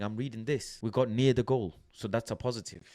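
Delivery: usual speaking voice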